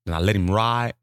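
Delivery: ordinary talk, nothing marked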